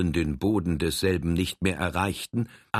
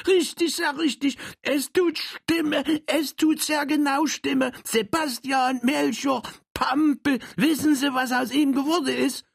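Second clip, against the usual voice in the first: excited high Saxon. Acted. Troll delights at the matching names, high excited. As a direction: bubble over with delight, high.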